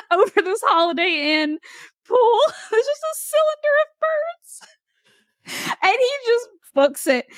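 barely holding it together; laughing. Real, not acted. Recalling the man fleeing the birds, cracking up high.

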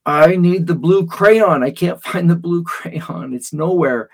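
loud, high, slow demanding whine; upset child. Retold or imitated. Girl tells dad she needs the blue crayon, loud and high. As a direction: plead like a five-year-old in a tantrum.

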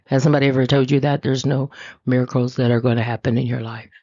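normal talking voice

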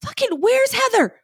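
high, exasperated dad voice; imitating a flustered father; mock frantic dad. Dad in background searching for his daughter, high exasperated mock.

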